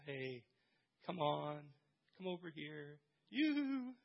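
mock sweet beckoning; wrong way, soft and sing-song; gentle coaxing. Shown as a demonstration. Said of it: "come isn't like a gentle".

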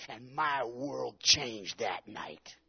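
loud and very slow, each word drawn out; slow emphatic climax. Real, not acted. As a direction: drive the climax home slowly. Sermon climax, slowed and loud.

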